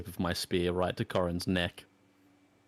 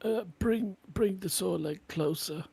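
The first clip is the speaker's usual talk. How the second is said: higher, wary in-character warning; gruff cautious hero; 9 semitones higher, 3 dB quieter than usual. Acted. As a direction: keep the wolf at spear's length. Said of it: "I'll tell him".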